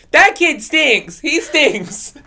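put-on loud, slow bratty kid taunt; sing-song it like a mean classmate; kid's taunt. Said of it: "he's like".

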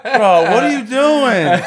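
loud, high, slow protest through laughter; mock outraged protest. Imitating prankster scolding his friends, mock outrage.

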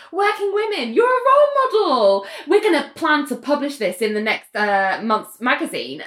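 high, gushing mock proclamation; hammed-up congratulations, settling toward the end; Laura Dipson. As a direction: imitate an overbearing organiser, pitch leaping up. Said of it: "And Laura Dibson goes".

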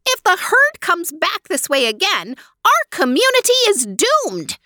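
high, alarmed little mouse voice; frightened mouse cry. Acted. Mouse warns the others, high alarmed cry. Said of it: "cried one mouse".

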